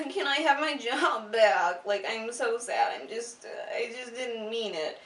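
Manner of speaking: hammed-up sad pleading for his job back; mock sad plea. Cassio begging to get his job back, mock sad whine. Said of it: "she's like (retold Cassio plea)".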